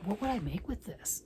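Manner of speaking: whispered puzzled question to herself; whispered thought. Retold or imitated. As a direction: breathe it out, puzzled. Quoting own puzzled thought.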